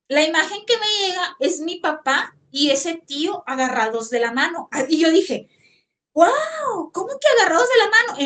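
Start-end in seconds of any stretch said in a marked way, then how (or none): 6.1-8.2 high, loud burst of amazement; a sudden wow of pure surprise; astonished disbelief. Retold or imitated. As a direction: gasp it out like you can't believe your eyes. Shocked at vision of father.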